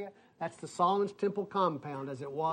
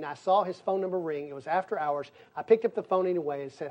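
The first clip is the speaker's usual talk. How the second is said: normal talking voice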